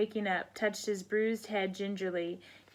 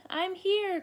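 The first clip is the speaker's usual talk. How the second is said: duckling's cry; 10 semitones higher than usual. Acted. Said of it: "cried Puddle".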